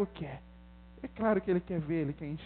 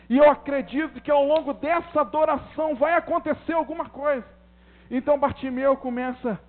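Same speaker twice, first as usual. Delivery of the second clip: raised, louder sermon voice; impassioned preaching; 6 semitones higher, 7 dB louder than usual. Real, not acted. Preacher promises worship will make something happen, forceful preaching. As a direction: build conviction with a high, forceful voice.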